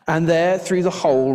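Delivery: plain reading voice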